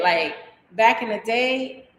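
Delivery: ordinary delivery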